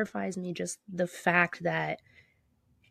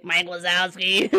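Roz impression; 4 dB louder, slower than usual. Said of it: "You sound like fucking Roz".

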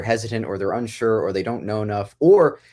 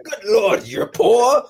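put-on high, loud billionaire's posh accent; 9 semitones higher, 5 dB louder, much slower than usual. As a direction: exclaim it in a hammed-up wealthy snob accent. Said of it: "whole accent to it".

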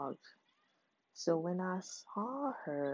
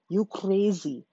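louder blunt accusation; 2 semitones higher, 8 dB louder than usual. Retold or imitated. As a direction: call her crazy to her face. Retold outburst at ex.

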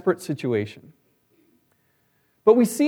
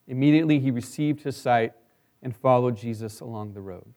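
measured Bible reading aloud; scripture reading; 7 semitones lower than usual. Acted. Scripture reading before sermon.

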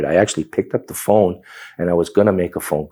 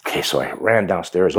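ordinary talk

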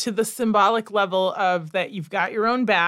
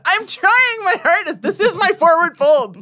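laughing mock protest; 8 semitones higher, 3 dB louder than usual. Retold, high laughing.

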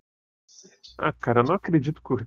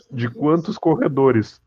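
usual speaking voice

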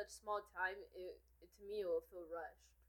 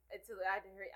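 ordinary talk, nothing marked

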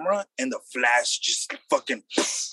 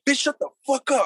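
retold yell; 10 semitones higher, 4 dB louder than usual. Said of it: "I'm like".

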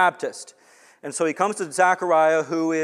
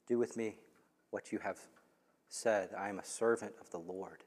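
humble surrender; 6 semitones lower, 13 dB quieter than usual. Retold or imitated. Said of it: "and says".

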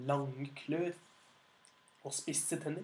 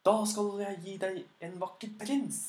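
higher, louder bargaining call; dragon calling out; 5 semitones higher, 5 dB louder than usual. Acted. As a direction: offer the prince eagerly, calling out. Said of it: "ropte, ropte dragen".

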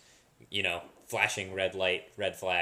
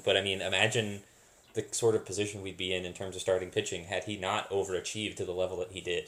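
normal talking voice

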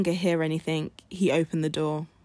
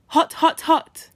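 high, loud cry of hot; shouted hot; 9 semitones higher, 6 dB louder, slower than usual. Acted. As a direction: yell it out like the heat just hit. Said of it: "you shout".

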